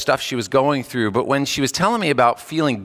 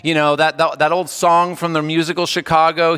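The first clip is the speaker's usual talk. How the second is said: ordinary delivery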